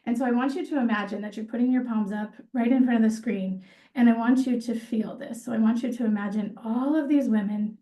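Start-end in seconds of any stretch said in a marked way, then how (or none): none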